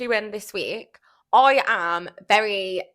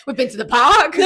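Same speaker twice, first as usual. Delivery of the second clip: laughing; 3 semitones higher, 9 dB louder than usual. Retold or imitated. Retelling friend's morning drop-off.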